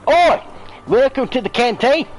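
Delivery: a brash Cockney Australian cook welcoming hunters. Acted. Chef Kitty greeting the new hunter in Cockney Australian, loud and high. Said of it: "Cockney Australian!".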